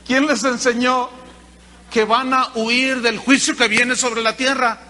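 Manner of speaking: loud rebuke; rebuking. Retold or imitated. John the Baptist rebuking the crowd, loud and stern. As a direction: scold the crowd from up front.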